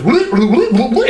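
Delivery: high, loud whoops for the shaking wheel; mock alarm whoop whoop; imitated wheel wobble. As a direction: bounce out the whoops like a wheel swinging. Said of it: "the wheel goes".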